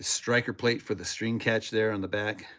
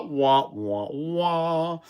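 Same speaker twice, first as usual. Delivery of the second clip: sad trombone wah; 3 semitones higher, much slower than usual. Pretend. Sad trombone for failed attempt.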